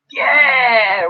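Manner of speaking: high, drawn-out mock cheer; imitated crowd shout. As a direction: imitate neighbours' belated goal celebration. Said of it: "we heard them shouting".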